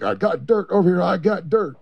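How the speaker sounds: high, eager call of a discovery; excited find. Retold or imitated. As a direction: call it out like you just struck gold. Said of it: "and he's like".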